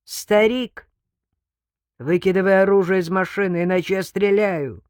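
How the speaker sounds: loud, threatening yell; forceful gunman's demand; shouting. A narrator's reading. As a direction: shout an order across a distance. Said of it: "потом закричал".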